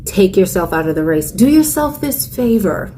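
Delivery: ordinary talk, nothing marked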